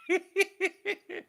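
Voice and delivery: high-pitched burst of laughter; sudden high giggle. Real, not acted. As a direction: cackle up high at your own joke. Bursts out laughing at his joke.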